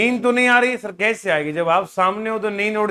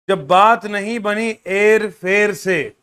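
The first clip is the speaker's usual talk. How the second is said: declaimed verse with drawn-out, punched words; poetry recitation; 4 dB louder than usual. Acted. Teacher reading a student's couplet to the class, declaimed. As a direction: recite it in slow, weighty phrases with pauses.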